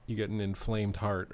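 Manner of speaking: ordinary talk, nothing marked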